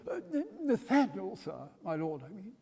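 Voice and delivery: startled timid reply; nervous young Winkle. A narrator's reading. Winkle flustered.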